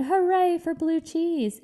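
high, slow cheer; mock cheer. The other mice cheering blue cheese, a high mock shout. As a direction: lift it high and stretch it out like a crowd of mice. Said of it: "the other mice shouted".

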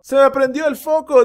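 high, loud eureka; hammed-up lightbulb moment; bright idea. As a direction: announce the idea proudly.

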